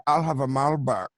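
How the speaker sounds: very dry pianist ordering. Retold or imitated. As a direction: impersonate a deadpan bar regular. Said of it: "very dry".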